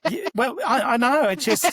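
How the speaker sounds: words breaking into laughter as he answers; laughing. Real, not acted. Laughing at the thought of it.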